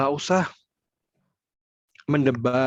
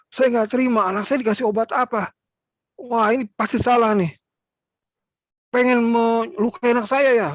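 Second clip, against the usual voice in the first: mock angry parent; 8 semitones higher than usual. Angry mother complaining to health worker, raised pitch. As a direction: confront the staff as an outraged mum.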